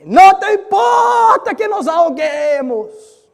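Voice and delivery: terrified disciples shouting over the storm; desperate shout. Shown as a demonstration. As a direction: scream it to wake the sleeping master. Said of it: "Es un grito fuerte".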